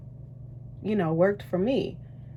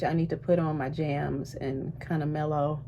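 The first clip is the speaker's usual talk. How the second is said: ordinary talk, nothing marked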